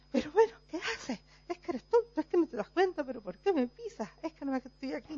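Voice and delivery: high, snappish telling-off of the clumsy one; mock angry scolding. Teacher voicing the hurt person scolding whoever stepped on her. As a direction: scold the person who stepped on you, a touch hammed-up. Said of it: "te da un pisotón".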